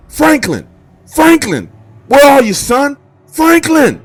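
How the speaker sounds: high, loud frantic yelling of a name; frantic screaming. Retold or imitated. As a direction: shout the name in a panic, searching for a lost son. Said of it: "my dad screaming frantically".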